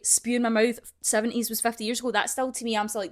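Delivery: usual speaking voice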